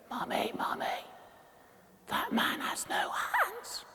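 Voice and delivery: put-on a small child whispering to mum; hushed little voice tugging at mum; child's whisper. Retelling a child whispering to his mother on the street. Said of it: "heard this little voice behind me".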